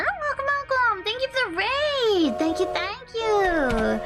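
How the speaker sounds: high, bubbly ghost conductor greeting; cute anime streamer. Acted. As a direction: welcome raiders in a sing-song high voice. Greeting raiders as character.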